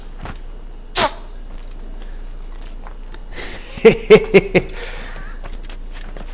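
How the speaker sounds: breathy villain laugh, unvoiced; evil snicker. Gamer laughing after throwing a guard off. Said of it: "Sou mesmo mau".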